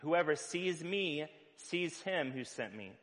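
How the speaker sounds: ordinary talk, nothing marked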